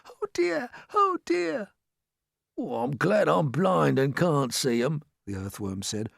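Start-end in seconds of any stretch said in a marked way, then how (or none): 0.0-1.7 very high, worried little voice; high-pitched anxious fretting; fretful dismay. A narrator's reading. Frightened creatures huddle for comfort. 2.6-5.0 slow, doleful earthworm voice; slow character voice, full of dread; fearful earthworm. A narrator's reading. Timid earthworm voiced by narrator.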